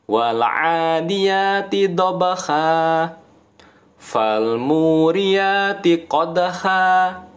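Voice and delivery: slow chant, dobakha and qadha end on long a; pausal recitation. Shown as a demonstration. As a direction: recite each verse end without the n. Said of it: "Bukan qadhan, tetapi qadha".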